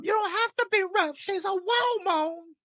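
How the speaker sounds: high mock-squeaky repeat of her line; mimicking her accent. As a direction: parrot her words in a squeaky, mocking voice. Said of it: "I love that accent".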